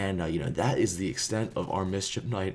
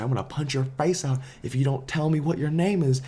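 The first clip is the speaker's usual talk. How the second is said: threat; 7 semitones higher than usual. Retold or imitated. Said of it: "and said".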